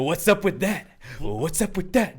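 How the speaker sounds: high sing-song sketch catchphrase; Keenan. Retold or imitated. Imitating a Saturday Night Live sketch, high catchphrase. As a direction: croon it like a cheesy show host. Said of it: "Keenan just pretended he was Steve Harvey".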